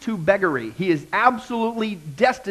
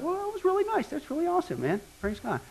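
pleased inner thought; 11 semitones higher, 6 dB quieter than usual. Retold or imitated. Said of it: "and I thought".

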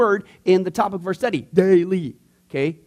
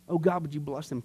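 quiet spontaneous prayer; 4 semitones lower, 9 dB quieter than usual. Retold or imitated. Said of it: "God might pray for 'em".

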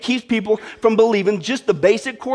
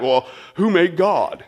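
mock skeptic; 4 semitones lower, slower than usual. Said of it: "you hear people say that".

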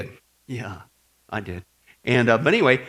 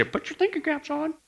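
high, soft sing-song teacher call; teacher's classroom voice; 11 semitones higher, 6 dB quieter than usual. Retold or imitated. Pastor imitating his old teacher telling the class, sing-song. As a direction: chirp it like a grade-school teacher. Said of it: "had a teacher growing up and say".

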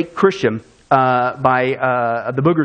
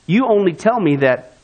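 ordinary talk

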